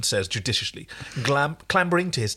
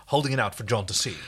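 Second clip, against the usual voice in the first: ordinary talk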